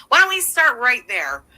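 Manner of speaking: ordinary delivery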